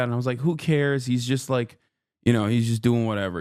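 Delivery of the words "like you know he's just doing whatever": ordinary talk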